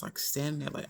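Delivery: normal talking voice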